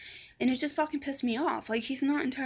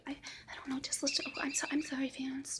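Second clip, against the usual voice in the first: hushed, higher, hesitant apology; small, whispery submissive voice; 4 semitones higher, 6 dB quieter than usual. Retold or imitated. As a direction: imitate a meek friend giving in at once.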